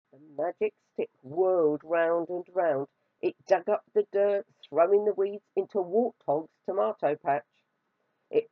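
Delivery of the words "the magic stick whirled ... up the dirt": plain reading voice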